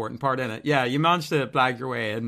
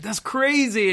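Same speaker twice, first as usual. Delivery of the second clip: high-pitched mock amazement; amazed tourist; 10 semitones higher, slower than usual. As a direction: imitate a wowed tourist.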